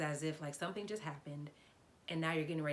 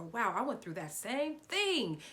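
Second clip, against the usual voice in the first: slow, emphatic, louder and higher amazement; excited recognition; 5 semitones higher, 5 dB louder, slower than usual. Acted. Actor relating to a character's speech, excited. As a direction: stretch it out, lifting with amazed recognition. Acts out reader recognizing herself.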